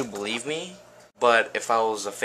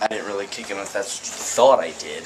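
ordinary talk, nothing marked

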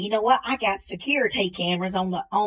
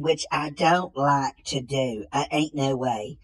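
ordinary talk

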